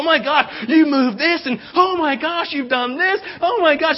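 high-pitched, gushing imitation of a friend; hammed-up breathless string of exclamations; so excited. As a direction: squeal over every new discovery. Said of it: "they're just so excited".

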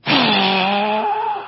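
distant frustrated sigh, heard through a wall; a heavy sigh from the next room; exasperated sigh from another room. Real, not acted. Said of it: "sigh from my wife".

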